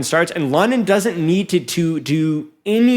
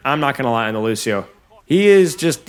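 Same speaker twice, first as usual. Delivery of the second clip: ordinary talk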